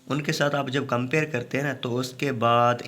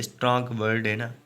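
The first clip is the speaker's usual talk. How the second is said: ordinary delivery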